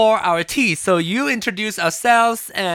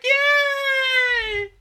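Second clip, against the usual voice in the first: cheering. Real, not acted.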